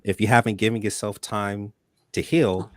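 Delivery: usual speaking voice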